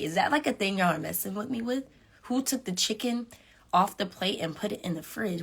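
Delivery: usual speaking voice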